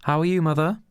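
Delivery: high, bright greeting to mother; son's voice. Acted. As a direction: lift it up like a dutiful son. Son greets bitter mother.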